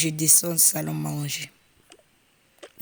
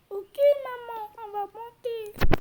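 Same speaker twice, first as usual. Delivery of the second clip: put-on very high child's voice, slower; petite voix d'enfant. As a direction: squeak it out like a little kid. Enfant répond à maman, voix aiguë. (petite voix aiguë d'enfant)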